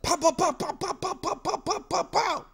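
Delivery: very high rapid pow pow gun sounds; gunshot noises. Retold or imitated. Felix firing at Rasputin, high rapid mock gunshots. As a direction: fire off squeaky pistol shots. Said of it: "Felix is like".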